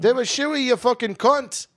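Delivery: put-on Australian accent. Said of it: "How do I say shooey in an Australian accent?".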